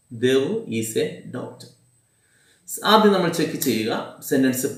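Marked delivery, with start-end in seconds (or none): 0.1-1.7 "Devu is a doctor" spaced out word by word; slow dictation. Shown as a demonstration. Teacher dictating an example sentence to students, very slow. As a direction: say it slowly so students can write it.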